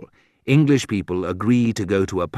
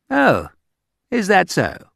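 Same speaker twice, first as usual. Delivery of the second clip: high, arch "is that so"; a hint of icy English politeness; mock polite disbelief; 10 semitones higher than usual. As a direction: sound politely doubtful, pitched much higher. Quoting polite English rudeness.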